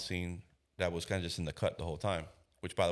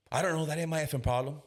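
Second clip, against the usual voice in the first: high, louder dismissive retort; mock defiance; 8 semitones higher, 3 dB louder than usual. Father refusing to help find the keys, mock defiance. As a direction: push the problem away with raised pitch.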